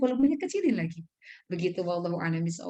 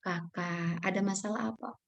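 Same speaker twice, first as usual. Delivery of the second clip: put-on very soft question to a child; volume dipelankan; 8 dB quieter than usual. Shown as a demonstration. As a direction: drop your volume right down to calm a child. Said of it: "maka volume kita". (bertanya dengan suara pelan)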